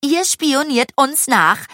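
high, loud accusation; indignant. A narrator's reading. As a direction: accuse them, outraged. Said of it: "sie schüttelte empört den Kopf".

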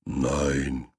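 low, soft, booming great dane; extremely deep bass voice. Acted. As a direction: answer from deep down, slow. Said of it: "antwortet mit tiefer Bassstimme".

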